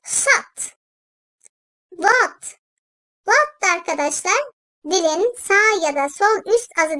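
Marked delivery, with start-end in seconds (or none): 0.1-0.8 "Sat" breathed with no voice, sharp s; "Sat" whispered; whispered sad. Shown as a demonstration. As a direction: isolate the emphatic s by whispering it. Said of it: "Kalın seslidir".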